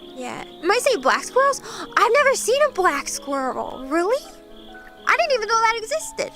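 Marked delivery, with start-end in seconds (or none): none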